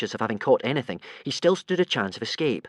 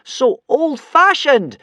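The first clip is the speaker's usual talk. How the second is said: very high, loud, slow shriek; frantic high shriek; 14 semitones higher, 10 dB louder, much slower than usual. Acted. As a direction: shriek it to stop him. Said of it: "shrieked Hiccup hurriedly".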